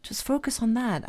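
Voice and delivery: gentle self-reassurance. Retold or imitated. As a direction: talk yourself down gently like a friend. Reassuring her own self-critic.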